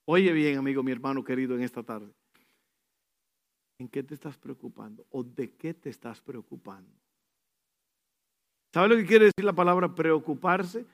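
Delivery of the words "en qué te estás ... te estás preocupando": intimate question. Acted. Pregunta íntima al oyente.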